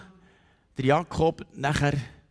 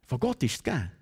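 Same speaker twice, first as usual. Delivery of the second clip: ordinary talk, nothing marked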